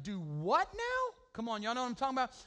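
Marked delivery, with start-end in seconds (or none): 0.0-1.1 slow, drawn-out mock double take; like opening a shock bill; stunned disbelief. Pastor retelling the hospital bill, mock stunned disbelief. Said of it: "we're like".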